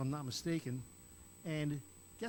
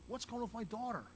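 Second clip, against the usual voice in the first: imitated fretting; 6 semitones higher, 4 dB quieter than usual. Waiting father asking about his daughter, mildly frantic. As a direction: fret it lightly in a raised pitch. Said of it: "He's still having a conniption".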